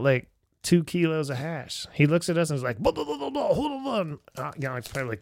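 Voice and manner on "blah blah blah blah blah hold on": high laughing gibberish, mocking the dealer; laughing mock babble. Dealer with the hash, mocked in a high laughing babble. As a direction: giggle through a babble of blahs.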